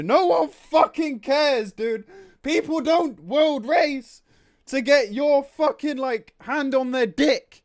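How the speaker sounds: mock squeaky voice, high and slow, ranting at the developers. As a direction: sneer it in a silly high voice at the company. Mocking rant after company statement.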